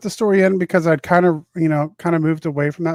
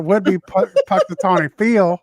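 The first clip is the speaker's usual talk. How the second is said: Southern accent; 3 semitones higher, 6 dB louder than usual. Real, not acted. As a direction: say it with a Southern twang. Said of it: "my Southern accent come out".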